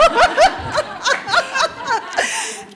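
langes, lautes Herauslachen; lautes Loslachen. Real, not acted. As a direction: lach hell und laut los. Bursts out laughing. (lautes, hohes Gelächter)

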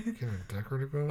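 quiet, quick aside with a laugh in it; under her breath. Retold or imitated. Imitating a character's jab under her breath, muttered. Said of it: "under her breath".

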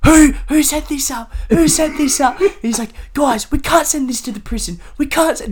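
high-pitched imitation of a flustered teacher; mock alarm, voice pushed way up. Teacher finding the class's prison notes, acted flustered. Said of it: "flustered and shit".

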